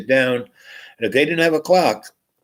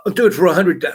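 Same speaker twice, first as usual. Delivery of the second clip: eager raised stakes; 6 semitones higher, 4 dB louder, much faster than usual. Retold or imitated.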